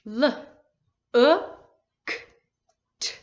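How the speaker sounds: each sound said apart with a pause between: L, U, K, T; sounding out letters. Shown as a demonstration. Teacher to class, sounding out the letters one by one. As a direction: stretch out L, U, K, T one at a time. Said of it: "I will say the sounds".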